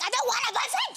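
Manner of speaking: high, mock-exasperated shout; exasperated retort. Pupil quoting her reply to everyone's boyfriend remarks, exasperated retort. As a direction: shout back an exaggerated protest. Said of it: "and I'm like".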